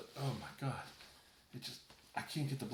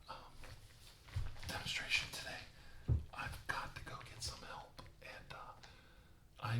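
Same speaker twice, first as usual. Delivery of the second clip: much softer whispered sign-off; fading whisper; 6 dB quieter than usual. Acted. Bleeding carver ends his soap demonstration for viewers in a fading whisper. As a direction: whisper out the last words, weak from blood loss.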